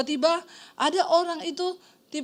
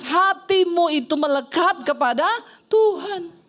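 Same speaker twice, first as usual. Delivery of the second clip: raised, insistent preacher's delivery; emphatic preaching; 2 semitones higher, 4 dB louder than usual. Acted. Pastor urging the congregation to cling to God, emphatic preaching. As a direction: preach it with weight on each key phrase.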